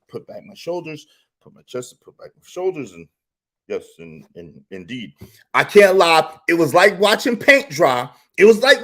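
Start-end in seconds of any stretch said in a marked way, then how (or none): 0.1-5.1 low, hushed, prim murmur; acting upright and studious quietly; mock proper posture voice. Vlogger showing his stiff patient panel self, hushed and prim. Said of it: "I tried to be patient".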